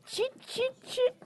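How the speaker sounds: very high, short "chit" noises; monkey chatter. A narrator's reading. Storyteller mimicking the copycat monkeys, high chittering.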